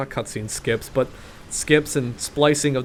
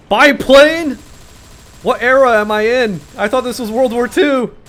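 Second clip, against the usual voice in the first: high, loud disbelief; can't believe what he sees; incredulous surprise; 9 semitones higher, 9 dB louder than usual. Real, not acted. Surprised by a biplane.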